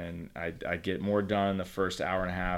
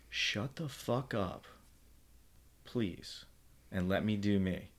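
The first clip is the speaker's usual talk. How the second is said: whisper; 7 dB quieter than usual. Retold or imitated. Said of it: "whisper unvoiced".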